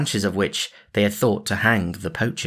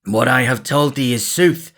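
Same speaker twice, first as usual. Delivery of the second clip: a hint of harsh Guy, louder; Guy of Gisborne's voice; 4 semitones higher, 5 dB louder than usual. A narrator's reading. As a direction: Robin keeping up the disguise. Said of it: "still in Guy of Gisborne's voice".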